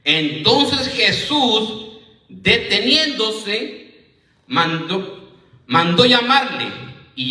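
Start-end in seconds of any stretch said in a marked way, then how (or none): 0.0-4.1 drawn out slowly, every syllable weighed; slow it right down to mark the key moment; preacher lingering on the turning point of the story; slow emphasis. Shown as a demonstration. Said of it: "Aquí viene lo bueno".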